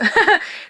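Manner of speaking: tearful laugh. Real, not acted. Laughs through tears about uncle.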